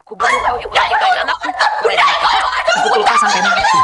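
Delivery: high shrieking threat broken by manic laughter; crazed laughing shriek. Acted. Mother-in-law shrieks at the wife who married her son, manic laughing scream. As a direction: cackle and scream the threat, unhinged.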